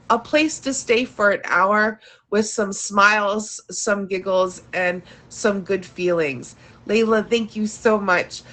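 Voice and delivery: ordinary talk, nothing marked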